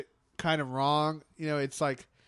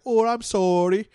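a hammed-up Canadian accent, louder; mock Canadian accent; 5 semitones higher, 7 dB louder than usual. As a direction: lay the Canadian accent on thick, mockingly. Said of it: "they'll kinda throw it on super heavy".

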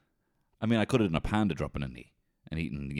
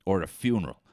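usual speaking voice

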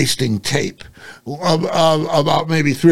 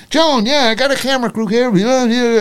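mock phone call; 6 semitones higher than usual. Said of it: "He says".

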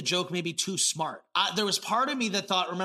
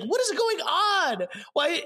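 baffled; 10 semitones higher, slower than usual. Retold or imitated. Outraged at absurd plot.

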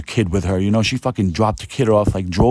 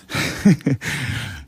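breathy, voiceless chuckle; 6 semitones higher than usual. Real, not acted. Storyteller amused at own tale, breathy chuckle. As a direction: let a quick laugh slip out. Laughs between story parts.

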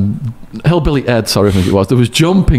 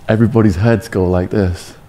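ordinary delivery, nothing marked

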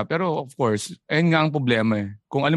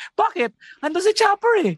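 mock neighbour. Mock quote of neighbours, high.

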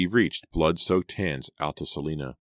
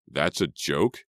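ordinary delivery, nothing marked

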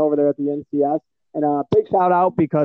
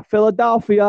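mock Philly accent; 7 semitones higher, 3 dB louder than usual. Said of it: "The only thing I can do with Philly".

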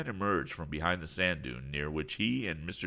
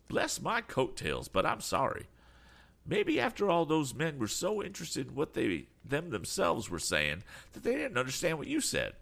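lightly voiced eccentric gentleman, higher-pitched; eccentric man, apologetic; 10 semitones higher than usual. A narrator's reading. Eccentric man consoling young inventor, mildly apologetic and hopeful.

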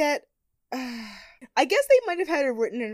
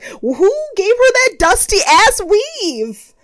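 exasperated rant; 9 semitones higher, 10 dB louder, slower than usual. Real, not acted. Ranting about hated weave.

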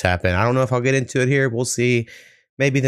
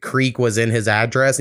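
ordinary talk

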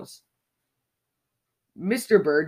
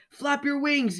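much higher yelled call; mother's yell; 10 semitones higher than usual. A narrator's reading. Mother bird calling to her falling baby, high yell. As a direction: shout up to him to keep flapping. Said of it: "yelled Miss Bird".

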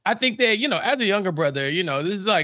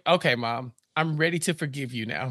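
low, softened, condescending grant of pardon; mock magnanimous; 7 semitones lower, 5 dB quieter than usual. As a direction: play the son lowering himself to forgive. Acts Harvey condescending to mom.

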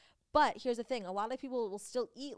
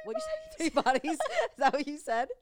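laughing out loud, much higher and louder; laughing teasing; 7 semitones higher, 9 dB louder than usual. Real, not acted. Teasing her co-host about "three bodies", laughing loudly. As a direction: crack up while teasing a friend's slip.

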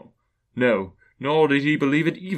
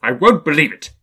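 raised, loud, stubborn refusal; defiant Scrooge; 5 semitones higher, 10 dB louder than usual. A narrator's reading. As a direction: refuse it loudly at a higher pitch.

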